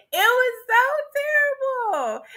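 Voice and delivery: high, drawn-out exclamation; amused wail. Real, not acted. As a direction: wail it slowly on the edge of laughter. Cracking up over the awful date.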